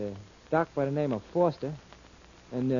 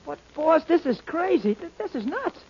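high, rattled disbelief; shocked disbelief; 10 semitones higher, 3 dB louder than usual. Acted. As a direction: stammer it out, stunned. Sidekick shocked by revelation.

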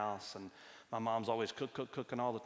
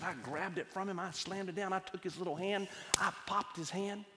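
higher, mounting exasperation as the actions pile up; retold snap of anger; 7 semitones higher than usual. Wife confessing she snapped at her toddler, mounting anger. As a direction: retell losing your temper, pitch climbing with each action. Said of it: "I just snapped".

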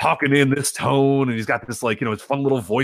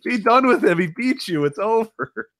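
mock exasperated plea; 11 semitones higher, much slower than usual. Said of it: "I'm like".

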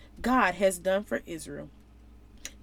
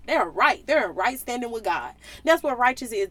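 ordinary talk

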